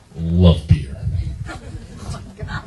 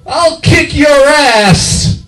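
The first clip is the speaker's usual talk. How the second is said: very high, loud squealed threat; unmanly threat; 5 dB louder, much slower than usual. Acted. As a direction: shriek it slowly in falsetto. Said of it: "I lose some of that masculinity".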